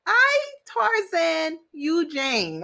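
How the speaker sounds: hammed-up Tarzan talk, slow and high; caveman Tarzan. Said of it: "they was like".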